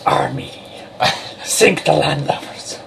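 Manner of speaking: slow growly pirate with rolled R's. Pretend. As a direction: play a pirate shouting at sea. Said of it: "Why did you sound like a pirate Khajiit".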